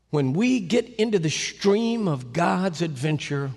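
usual speaking voice

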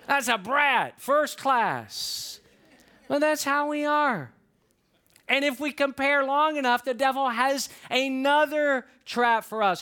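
ordinary delivery

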